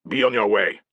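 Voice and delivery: loud, sharp sentry shout; shouted warning. Acted. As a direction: call out to warn him off. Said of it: "cried the sentry in bad Latin".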